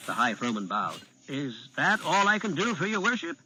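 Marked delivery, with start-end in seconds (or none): 1.3-3.4 higher, deferential voice of a Geg dignitary; bow and scrape with your voice; fawning Geg official. Acted.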